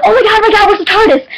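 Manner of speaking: high-pitched frantic excitement; mock freak-out. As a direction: blurt it out in a retold panic. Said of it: "freaking my shit".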